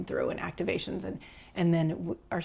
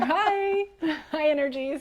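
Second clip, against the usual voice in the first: playful greeting; 10 semitones higher, 5 dB louder than usual. Acted. Greets the arriving energies playfully.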